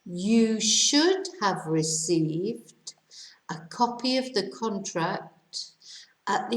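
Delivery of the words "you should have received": should have received said slowly, should stressed; teacher modelling the right form slowly; slow model answer. Shown as a demonstration. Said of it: "Here's the answer".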